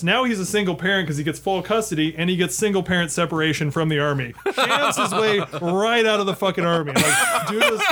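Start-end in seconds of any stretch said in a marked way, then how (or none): 4.5-7.8 laughing. Real, not acted. Laughing at the punchline.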